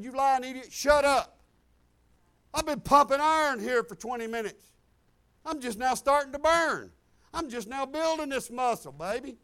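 loud, scornful answer to the devil; defiant shout. Retold or imitated. Preacher shouting down the devil's lie. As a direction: bark it back at the liar. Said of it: "I got up and I said".

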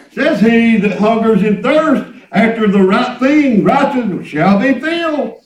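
full-voiced scripture declamation; preaching from scripture. Real, not acted. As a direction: proclaim the verse to the congregation.

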